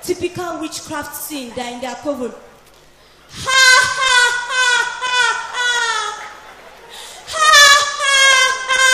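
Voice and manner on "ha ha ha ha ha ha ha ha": high happy witch cackle. Acted. Witches in their coven.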